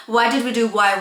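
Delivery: usual speaking voice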